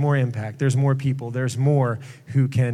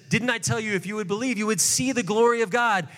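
mildly aggravated; 6 semitones higher than usual. Retold or imitated. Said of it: "a little aggravated at this point".

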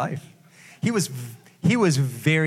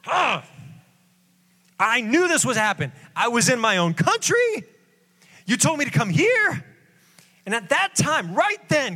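exasperated complainer; 9 semitones higher than usual. Acted. Preacher acting a frustrated believer griping at God, high whine. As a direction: throw up your hands at God's kindness. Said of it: "and you're like".

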